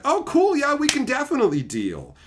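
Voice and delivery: high, breezy replay of sudden willingness; mock-cheerful agreement. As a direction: perk right up, as if nothing happened. Feigned cheer once trader gives in.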